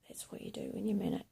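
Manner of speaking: confiding whisper about being manic; hushed whisper. Real, not acted. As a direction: breathe it out like a secret.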